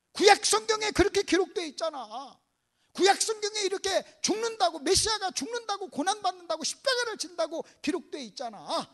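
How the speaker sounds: staged exasperated reminder; acted reproach. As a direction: list it as if they should have known. Acted scolding over ignorance of scripture.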